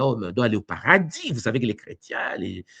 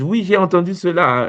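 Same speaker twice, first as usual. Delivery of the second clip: raised, louder mock viewer quote; mimicking a viewer; 7 semitones higher, 8 dB louder than usual. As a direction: play a viewer answering eagerly. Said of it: "il y a des gens qui me disent".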